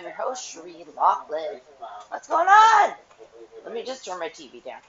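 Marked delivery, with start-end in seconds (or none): 2.1-2.9 high, loud hello; excited greeting. Real, not acted. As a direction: call out the greeting brightly. Vlog greeting called out.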